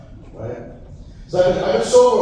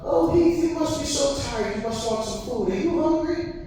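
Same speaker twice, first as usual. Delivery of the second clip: put-on high, sweet old-lady voice; sweet grandmother; 9 semitones higher than usual. Grandmother fussing over her tired grandson in a sweet high voice. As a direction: dote on him like a grandma. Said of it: "And my sweet grandmother".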